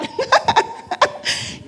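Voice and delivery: high breathy burst of laughter; airy laugh. Real, not acted. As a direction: laugh out at the irony. Laughs at working in child welfare. (risa alta y soplada)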